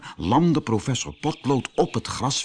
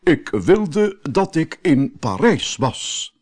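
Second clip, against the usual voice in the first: solemn wish; 4 semitones higher, slower than usual. Acted. Professor making his wish to reach Paris, slow and solemn. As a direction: speak each word carefully like a spell. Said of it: "haalde hij diep adem en zei".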